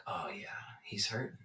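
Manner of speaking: low, soft inner voice; almost whisper it to yourself; hushed realization. Retold or imitated. Driver realizing the other man's pain, hushed realization.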